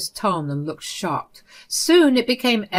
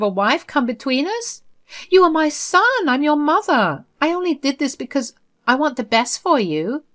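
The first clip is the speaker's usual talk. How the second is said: much higher, whiny pleading mother, hint of crying; mock tearful plea; 8 semitones higher, 4 dB louder than usual. Mother placating her angry son, higher tearful pleading. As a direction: play the wounded mother to win him back.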